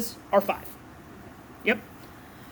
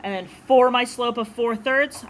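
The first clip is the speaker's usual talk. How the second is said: ordinary talk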